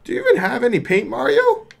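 very high, excited put-on voice; high game character. Urging Mario during the battle, high-pitched voice. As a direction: call the fight in a high character voice.

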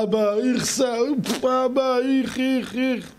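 put-on high, whiny little boy complaining "איך, איך"; whining child. Child whining to his dad after the dentist, in a high whiny voice. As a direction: whine like a kid just out of the dentist. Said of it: "אז הוא אומר".

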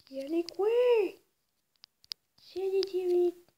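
loud, high call to the dog; calling from far away. Acted. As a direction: shout for the dog to come.

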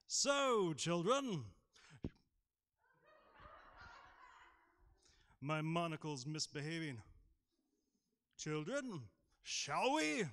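high, loud, plummy aristocrat voice; hammed-up upper-class toff with monocle; fancy posh voice. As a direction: ham up a posh gentleman for the kids. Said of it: "can I be fancy this morning?".

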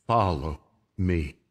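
Jesus calling gently; soft summoning; quiet call. Shown as a demonstration. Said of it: "What did he say".

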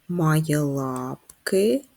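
very slow, drawn-out hint; slow teasing clue for the class. Shown as a demonstration. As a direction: stretch each word out to tease.

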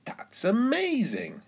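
high, swooping astonishment; high-pitched exclamation of wonder; amazed cry. A narrator's reading. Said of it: "cries Miss Rabbit".

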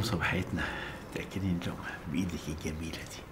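soft intimate whisper. Acted. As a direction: breathe it close and tender. Newlyweds whisper tenderly. (همس رقيق)

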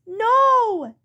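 high whiny refusal; protesting child. Acted. Child protests longer timeout.

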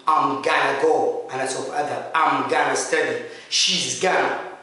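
slow, "gonna" for "going to" clearly; gonna reduction. Shown as a demonstration. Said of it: "I am going to". ("gonna" ببطء ووضوح)

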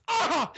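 very high, loud yelp; pained yelp. Retold or imitated. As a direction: cry out as if hit. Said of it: "noise he makes".

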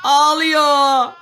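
high, loud exclamation at a crying doll; excited cry-out. Real, not acted. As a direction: call it out loud and high, drawing it out. Doll suddenly starts crying. (heyecanlı bağırış)

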